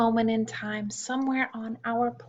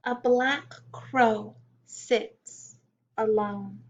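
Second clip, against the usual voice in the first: put-on reading a poem to children. A narrator's reading.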